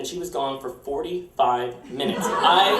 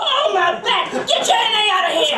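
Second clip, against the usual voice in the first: high, loud laughing complaint; mock boss yelping while laughing; imitating Dee Dee laughing; 5 dB louder than usual. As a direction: laugh and groan through the pain. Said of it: "making her laugh went like this".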